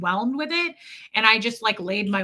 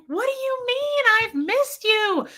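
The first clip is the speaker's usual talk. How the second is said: high, delighted welcome; excited welcome; 12 semitones higher than usual. Retold or imitated. Shop owner welcoming her back, high delighted voice. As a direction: sound thrilled she's back.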